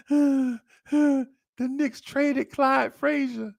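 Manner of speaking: very slow, high, sobbing-edged words; a heartbroken kid getting it out through tears; crying boy. Retold or imitated. Boy tells Delia why he cries, tearful. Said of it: "and I'm crying".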